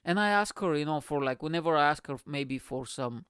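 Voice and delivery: ordinary talk, nothing marked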